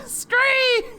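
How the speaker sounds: very high, piercing scree; bird screech. Acted. Rival bird's screech.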